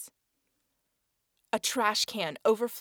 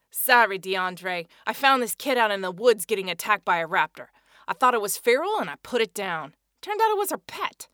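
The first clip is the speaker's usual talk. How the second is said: lightly voiced Eddie excusing himself; 8 dB louder than usual. Eddie explains to Milner.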